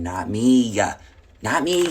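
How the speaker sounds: almost shouting it, insisting; emphatic. Real, not acted. Insisting she is not the other woman.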